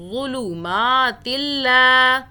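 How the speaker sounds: ظلمات اللہ chanted slowly and loud, ما held; Quran recitation. Shown as a demonstration. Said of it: "ان کو نہیں کھینچ کے پڑھنا".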